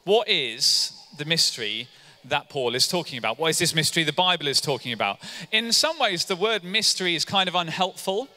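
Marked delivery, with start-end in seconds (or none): none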